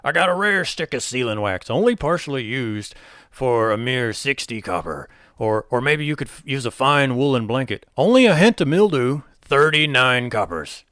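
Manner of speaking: goblin hawking. Acted. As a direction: bark out the wares like a pushy goblin. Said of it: "he keeps hawking".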